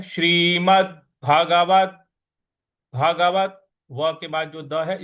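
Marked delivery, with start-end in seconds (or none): 0.0-3.7 Bhagavat slow, final द firmly hit; stress on final d. Shown as a demonstration. Teacher drills stress in Bhagavat. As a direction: hit the last consonant of Bhagavat. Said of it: "इस पर भी हमको आघात देना है".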